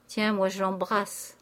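usual speaking voice

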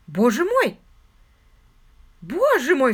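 loud, startled exclamation; astonished cry. A narrator's reading. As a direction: cry it out in shock. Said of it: "вскричала она".

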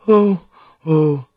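high, loud exclamations of shock; shocked oohs. A narrator's reading. Listeners hear about the teeth, high shocked ohs. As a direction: gasp out two scandalised ohs.

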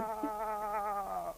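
long high wail, held back in volume; distant scream. Acted. Bear walks off as hanging rabbit keeps up a high scream. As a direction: scream heard from way off, high and drawn out.

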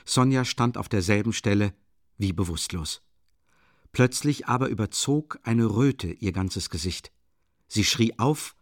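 plain reading voice

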